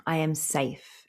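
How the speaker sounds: soft affirmation. Shown as a demonstration. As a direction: let each word settle as you say it. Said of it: "repeat it as many times as you like".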